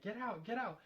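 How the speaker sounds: mild, high mock yell; half-volume imitation of yelling; retold yelling. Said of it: "like, yelling".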